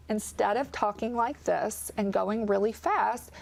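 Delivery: slow, clearly enunciated take. Shown as a demonstration. Audiologist modelling better speech for viewers, slow and clear. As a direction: ease off the rate, each word clear. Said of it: "you just slowed down the rate of speech".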